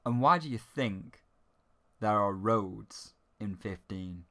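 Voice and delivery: normal talking voice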